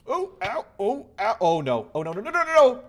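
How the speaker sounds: high panicked cries. Real, not acted. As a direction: panic as health drops. Nearly dies in lava.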